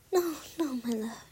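ordinary delivery

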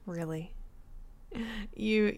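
ordinary talk

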